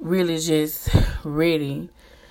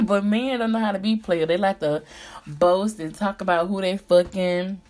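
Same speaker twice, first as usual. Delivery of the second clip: ordinary talk, nothing marked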